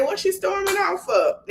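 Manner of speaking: high-pitched imitated disbelief; startled. Imitating a friend as someone storms off, mock startled. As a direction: pitch it high like a taken-aback onlooker.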